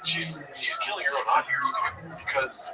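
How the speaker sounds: ordinary delivery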